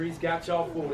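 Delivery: normal talking voice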